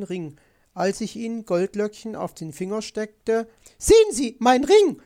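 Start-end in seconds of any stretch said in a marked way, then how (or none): 3.8-5.0 high, loud excited shriek; shrieking magpie, very high; crying out. A narrator's reading. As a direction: cry out in sudden triumph. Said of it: "schrie Frau Elster auf".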